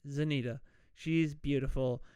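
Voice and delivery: usual speaking voice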